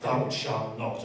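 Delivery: low mock-solemn recital, slowing at the end; lightly voiced Bible-quoting zealot; mock scripture quoting. Inmate quoting scripture at others, mock-solemn. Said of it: "I'd be like".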